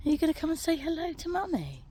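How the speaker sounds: high, soft, fast coaxing pet talk. Real, not acted. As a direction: coax the pet in a sing-song high voice. Talking to pet Baby Noodles.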